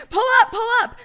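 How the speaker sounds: high, loud hurried calls; urgent shout. A narrator's reading. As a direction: yell to haul him up.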